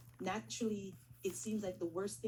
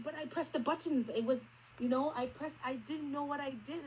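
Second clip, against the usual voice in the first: higher, louder anxious protest; retold distress, voice rising and louder; upset pleading; 7 semitones higher, 5 dB louder than usual. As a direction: pour out the panic to the advisor. Retelling panic to advisor.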